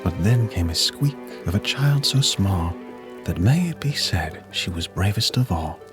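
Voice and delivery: plain reading voice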